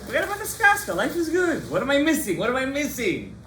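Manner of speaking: high, carefree voice of a smug skeptic; hammed-up voice of a man who misses nothing.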